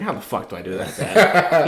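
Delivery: half-laughing helpless question; laughing disbelief. Retold or imitated. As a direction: laugh through the confession.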